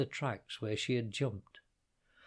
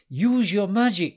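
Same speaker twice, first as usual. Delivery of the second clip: high, loud pleading cry; urgent cry; 8 semitones higher, 11 dB louder than usual. Acted. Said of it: "cried Hudayfa".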